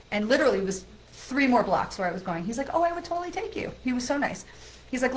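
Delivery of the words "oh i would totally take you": lightly voiced officer, higher pitch; obliging officer. Officer offers driver a ride two blocks, lightly imitated, obliging.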